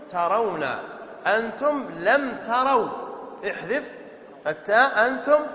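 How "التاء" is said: ordinary talk, nothing marked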